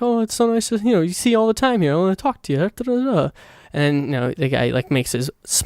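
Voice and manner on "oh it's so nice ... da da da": high, lightly voiced sweet-talker; mock flirty pie guy. Retelling the pie guy chatting him up, in a high mock voice. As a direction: pitch it up and gush like a smitten counterman. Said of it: "the pie guy is like".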